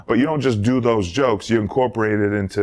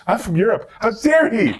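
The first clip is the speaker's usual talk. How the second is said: high-pitched, haughty indignation; mock outrage; 12 semitones higher than usual. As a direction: play grandly offended, voice pitched way up. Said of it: "That went through my head".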